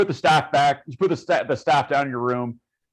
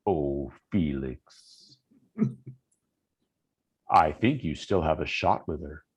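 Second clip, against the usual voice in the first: deep-pitched, softer inner voice; voice in the head; 8 semitones lower, 5 dB quieter than usual. Acted. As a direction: speak low and unhurried, insinuating into his thoughts. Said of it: "you hear in your head".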